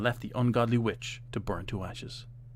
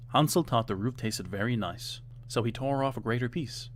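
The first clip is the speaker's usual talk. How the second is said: usual speaking voice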